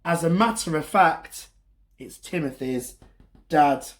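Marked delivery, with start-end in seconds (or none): none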